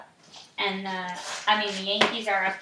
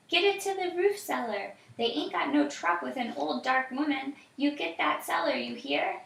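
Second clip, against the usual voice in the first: put-on higher, urgent old woman's voice; 6 semitones higher than usual. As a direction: hurry the children into hiding. Old woman urges children to hide.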